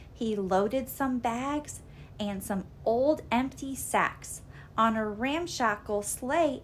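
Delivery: usual speaking voice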